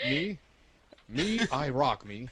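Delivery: high, loud mock boasting; mock self-praise. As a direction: play the vain self-admirer.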